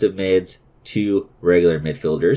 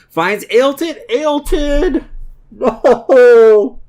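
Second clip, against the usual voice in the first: excited shout; 7 dB louder, much slower than usual. Real, not acted. Live excited commentary, then despair.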